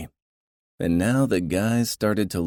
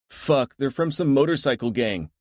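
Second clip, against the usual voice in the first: shouting; 9 semitones higher than usual. A narrator's reading. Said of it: "one of the guys shouted".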